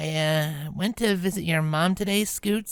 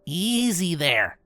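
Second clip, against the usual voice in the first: calm and low; 5 semitones lower than usual. Acted. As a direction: settle a panicking kid without raising your voice. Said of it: "Rainbow kept her voice calm and low".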